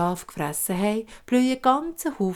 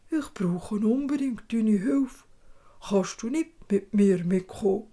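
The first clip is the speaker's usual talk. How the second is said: dwarf begging the father to come. Acted. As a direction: ask for help as a strange dwarf. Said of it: "sagt er zum Vater".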